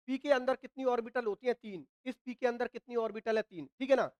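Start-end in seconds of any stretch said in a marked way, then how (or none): none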